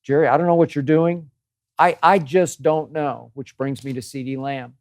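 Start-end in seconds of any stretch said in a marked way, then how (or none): 0.0-3.3 raised, baffled exasperation at Jerry; I just don't know, said in loud disbelief; exasperated. Real, not acted. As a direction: throw up your hands, louder and higher.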